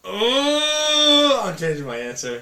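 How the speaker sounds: high, loud squealing oh, then the answer; excited outburst. Real, not acted. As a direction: burst out as if it just hit you. Excited switch of favorite movie.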